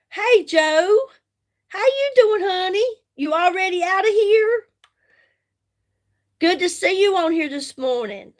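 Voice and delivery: bright, high friendly hello; cheerful greeting. Real, not acted. Greeting a viewer on live stream.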